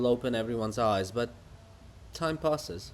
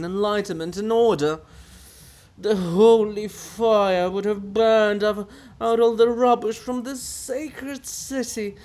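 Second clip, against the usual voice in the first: exalted writer dreaming of renewal; fervent prophecy; 8 semitones higher, 8 dB louder than usual. Acted. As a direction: preach the holy fire. Bulgakov's fervent vision.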